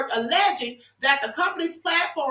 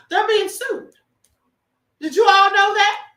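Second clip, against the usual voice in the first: surprised exclamation; 6 semitones higher, 6 dB louder than usual. Real, not acted. Reveals lawsuit to her viewers.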